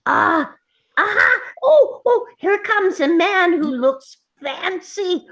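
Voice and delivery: high, rising excited exclamations; excited discovery. Acted. Gnome spotting a knight through his telescope, excited. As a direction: crow with glee at what you spot.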